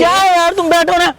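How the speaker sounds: high, loud exasperated order. Acted. Husband tells wife to sit down, exasperated. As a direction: burst out, fed up, voice jumping up.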